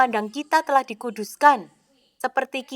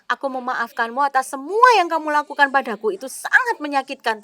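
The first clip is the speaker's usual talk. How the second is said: mock forgiveness; 3 semitones higher, 6 dB louder than usual. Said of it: "suami Anda berkata".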